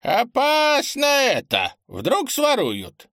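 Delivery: put-on high, slow old man shouting in alarm. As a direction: cry out the danger, drawn out. Old man alarmed about theft.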